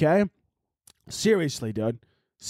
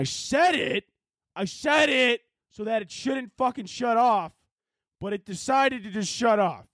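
high, slow indignant yell; fed-up rant; angry shout; 9 semitones higher, slower than usual. Real, not acted. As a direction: stress each word in outrage. Laptop dies mid-recording.

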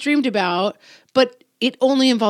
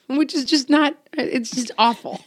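laughing; 4 semitones higher than usual. Real, not acted. Laughing at own story.

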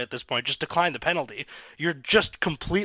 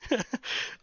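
breathy laugh; 9 semitones higher, 5 dB quieter than usual. Real, not acted. Laughing at the team's loss.

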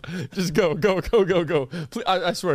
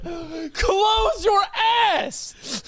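laughing shout; slower than usual. Real, not acted. Cracking up and shouting at his friend over a fart. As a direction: crack up while yelling.

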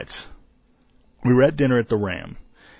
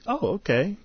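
higher, hesitant mock reply; a little apprehensive; 10 semitones higher than usual. Actress answering an eager fanboy, a little apprehensive. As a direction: imitate a slightly wary, polite agreement. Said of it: "kinda a little apprehensive".

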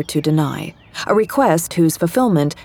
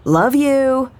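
teasing call, higher and raised; parting jab called up to Kevin, raised and higher; calling up the stairs; 8 semitones higher than usual. A narrator's reading. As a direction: toss it up the stairs playfully. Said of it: "I called to him".

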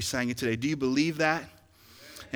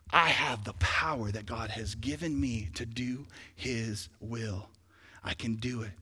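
soft inner voice; 2 semitones lower, 7 dB quieter than usual. Pretend. Imagined believer's inner voice.

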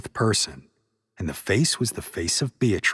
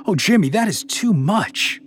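high shocked outburst, a little louder; shocked gasp; 12 semitones higher, 6 dB louder than usual. A narrator's reading. Candace shocked at the Oracle's question. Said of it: "Candace gasped, shocked".

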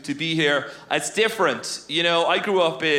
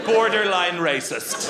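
ordinary talk

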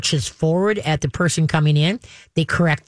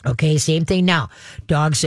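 ordinary talk

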